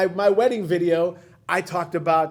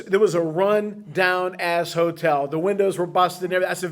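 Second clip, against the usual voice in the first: ordinary talk, nothing marked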